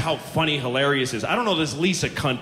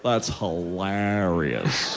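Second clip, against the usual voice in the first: low, very slow, slack dude; dopey guy; 11 semitones lower, much slower than usual. Retold or imitated. Comedian mimicking the guy's reply about Lisa, slack and dopey. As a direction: drag "hilarious" out low and lifeless. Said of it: "And he goes".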